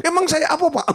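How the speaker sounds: high, offended outburst; angry wife. Retold or imitated. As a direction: snap back in mock anger. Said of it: "Malah marah sama". (istri marah, nada tinggi)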